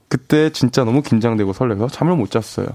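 ordinary talk, nothing marked

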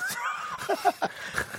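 high burst of laughter. Real, not acted.

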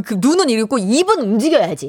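usual speaking voice

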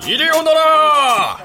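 loud call. Acted. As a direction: call out to the house from outside. Traveler calls at the inn.

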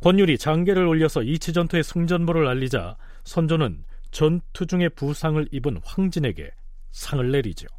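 ordinary talk, nothing marked

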